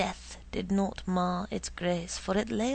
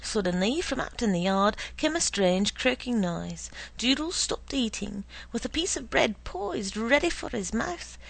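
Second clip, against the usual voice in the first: ordinary delivery, nothing marked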